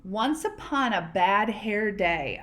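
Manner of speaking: ordinary delivery, nothing marked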